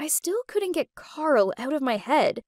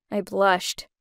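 ordinary delivery, nothing marked

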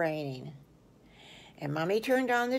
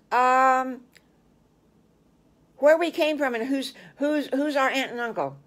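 put-on high, eager little-boy voice; children piping up to their teacher, high and eager; kid voice; 6 semitones higher, 5 dB louder than usual. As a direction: answer like an unsure small child. Children answering teacher's question.

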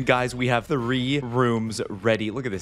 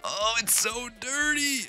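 high, drawn-out disgust at the room; 12 semitones higher, 4 dB quieter than usual. Real, not acted. Streamer finds a filthy room, drawn-out disgust. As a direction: recoil at the mess, slowly.

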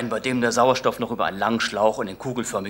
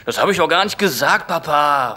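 put-on raised, higher kid's protest; defensive protest; 6 semitones higher, 6 dB louder than usual. Son defending himself to his father, raised protest. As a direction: defend yourself to Dad, louder. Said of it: "verteidigte ich mich".

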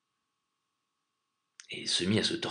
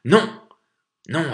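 loud, high desperate shout; urgent shouted protest; crying out; 9 semitones higher, 10 dB louder than usual. A narrator's reading. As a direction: cry out to stop a killing. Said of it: "une voix cria".

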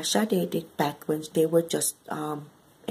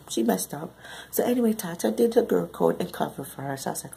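normal talking voice

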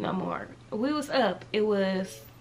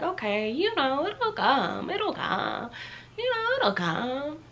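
mock calm reassurance; 7 semitones higher, slower than usual. Self-reassuring quote, rising pitch.

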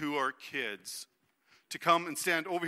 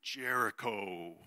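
ominous announcement; 10 semitones lower, 9 dB quieter, much slower than usual. Acted. Names the enemy city dramatically.

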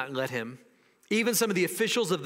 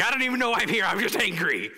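higher, put-on angry voice; mock angry mob member; 5 semitones higher, 4 dB louder than usual. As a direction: act out a clueless, worked-up protester. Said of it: "because there's an angry mob".

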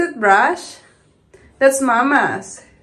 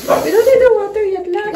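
higher and louder, faster insistence; firmer repeat; 8 semitones higher, 5 dB louder than usual. Real, not acted.

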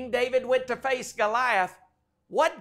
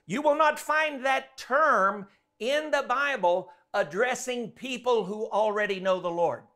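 usual speaking voice

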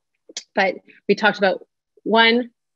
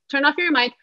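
high, sharp bossing about; teacher-parent barking rules and no; bossy no tone; 6 semitones higher, faster than usual. Shown as a demonstration. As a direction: bark orders at the group. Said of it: "This is what our children are hearing".

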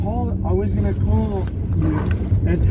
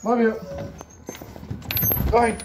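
normal talking voice

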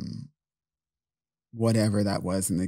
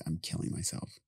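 unvoiced whisper of despair; 3 semitones lower, 11 dB quieter than usual. Whispering to the boyfriend that he's killing himself. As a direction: breathe it out with no voice.

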